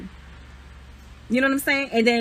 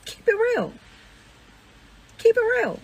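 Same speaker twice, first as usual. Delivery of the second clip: mock high squeak; 11 semitones higher than usual. Mocking holy talk.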